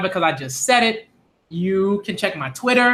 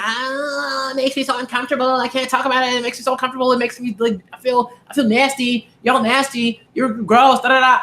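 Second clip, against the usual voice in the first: higher, whiny mock disgust; a higher whiny put-on of a guy who says it makes him uncomfortable; mock squeamish complaint; 5 semitones higher than usual. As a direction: play the squeamish guy griping. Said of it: "They're like".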